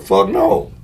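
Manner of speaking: sudden shouted fuck no; loud refusal. Retold or imitated. Man turning down the daughter's money demand, shouting. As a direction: blurt it out, shocked and loud.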